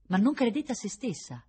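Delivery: plain reading voice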